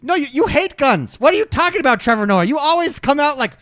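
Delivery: high, loud mock scolding; indignant call-out. Host calls out Trevor Noah over guns, indignant. Mock confrontation of absent celebrity.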